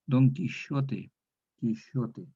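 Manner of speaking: "Quijote" said as Kishote, j like sh; "Quijote" with the old sh sound instead of the modern throaty j. Shown as a demonstration. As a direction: give "Don Quijote" slowly the old sh, Ki-sho-te. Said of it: "Es para pronunciarlo como J antigua".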